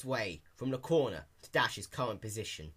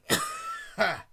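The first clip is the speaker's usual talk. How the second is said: put-on old man cough; 11 semitones higher, 5 dB louder than usual. A narrator's reading. Elderly pony coughs.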